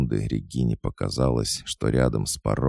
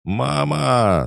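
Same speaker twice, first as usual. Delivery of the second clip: very high, loud cry of terror; frightened scream; 14 semitones higher, 5 dB louder than usual. A narrator's reading. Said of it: "закричал испуганный мужской голос".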